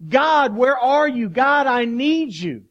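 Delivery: loud, high, drawn-out desperate plea; anguished cry, slow and loud; crying out to God. Acted. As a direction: cry out to God as if calling for help. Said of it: "He's crying out".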